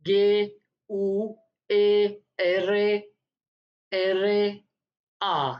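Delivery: slow letter-by-letter spelling; dictating the letters slowly; spelled out slowly. Shown as a demonstration. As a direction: drag out each letter for the class. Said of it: "जैसे कि गेरा".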